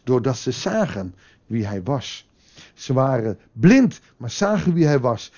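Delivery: normal talking voice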